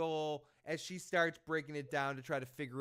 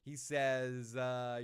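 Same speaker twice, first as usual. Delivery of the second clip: normal talking voice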